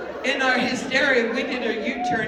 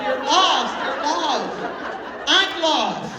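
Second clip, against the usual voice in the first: loud, raised, wailing repeats; hysterical wail; 5 semitones higher, 6 dB louder, slower than usual. Retold or imitated. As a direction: wail each phrase in comic despair. Retold hysterical quarrel over being lost.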